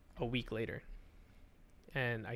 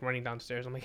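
normal talking voice

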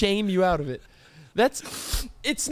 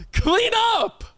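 sharp high "clean up" shout; mock alarm call; 12 semitones higher, 3 dB louder than usual. Real, not acted. Mocking friend's spit.

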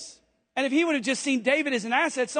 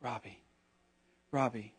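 softer, lower soothing call of "Robbie"; wife soothing her pacing husband, softly and lower; calming wife; 10 semitones lower, 8 dB quieter than usual. Retold or imitated. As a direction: gently settle a panicking husband. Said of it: "and she's like".

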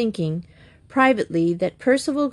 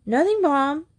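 high, hurried denial; hasty; 8 semitones higher than usual. A narrator's reading. Boy retreating from his mother to his room, hasty. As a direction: brush it off innocently in a higher voice. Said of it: "said Blake hastily".

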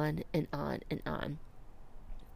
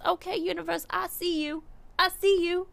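high, loud, delighted; thrilled, calling up to the universe; excited; 11 semitones higher, 7 dB louder than usual. Real, not acted. Excited at seeing 333.

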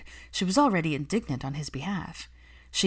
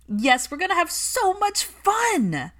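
much higher, louder, slow and sing-song; bubbly excitement; 13 semitones higher, 6 dB louder, slower than usual. A narrator's reading. Nanny promises the boy a fun day, bubbly and eager. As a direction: gush like a thrilled nanny. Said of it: "There was an energy about her".

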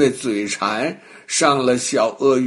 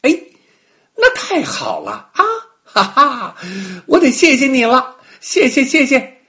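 delighted monkey; 11 semitones higher, 7 dB louder than usual. Acted.

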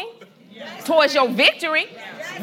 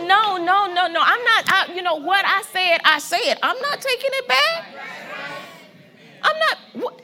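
higher, emphatic refusal; excited voicing of God; 6 semitones higher than usual.

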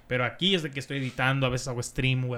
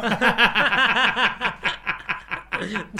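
loud laugh; 6 semitones higher, 6 dB louder than usual. Real, not acted. Laughs at own Messi joke.

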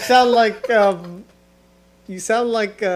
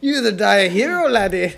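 put-on Scottish accent; 3 dB louder than usual. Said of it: "you sound like Gerard Butler".

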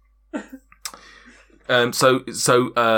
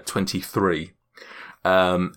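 normal talking voice

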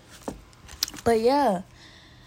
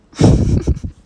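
a loud, breathy burst of laughter; 11 dB louder than usual. Real, not acted. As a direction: crack up out loud. Laughing at being grateful.

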